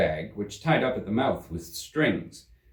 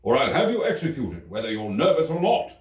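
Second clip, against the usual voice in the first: louder, threatening royal voice; angry King threatening; 4 semitones higher, 4 dB louder than usual. Acted. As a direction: threaten the witness crossly. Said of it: "the King repeated angrily".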